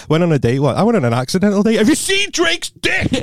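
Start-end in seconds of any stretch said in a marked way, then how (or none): 1.8-3.1 high, drawn-out comic squeal; mock blurt. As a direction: squeal the question slowly for laughs. Acted date blurting question.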